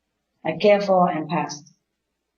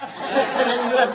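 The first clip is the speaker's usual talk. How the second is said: laughing; 4 semitones higher, slower than usual. Pretend. Mock quick meditation ends.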